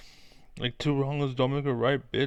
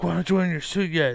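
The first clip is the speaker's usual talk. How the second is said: mock nagging; 6 semitones higher, 5 dB louder, much faster than usual. Mock nagging quote in rant.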